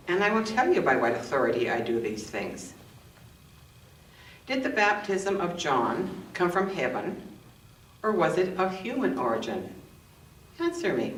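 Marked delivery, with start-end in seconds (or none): none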